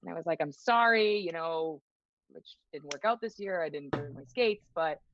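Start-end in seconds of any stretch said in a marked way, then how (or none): 0.4-1.2 higher, louder apologetic plea; retold guilty apology. Player apologizing to a fan's mother, guilty. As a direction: apologize as if letting someone down, lightly acted.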